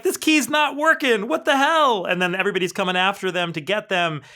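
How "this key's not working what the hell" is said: higher, louder frantic voice; panicked Zot. Retold or imitated. Zot panicking as the goons close in, mock frantic. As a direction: fake panic over a stuck key.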